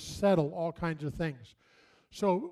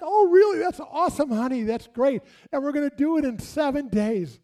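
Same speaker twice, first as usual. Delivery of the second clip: much higher, loud overjoyed parent voice; gushing parents; 7 semitones higher, 5 dB louder than usual. Retold or imitated. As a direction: parents gushing over their daughter's wedding news. Parents gushing at daughter's news.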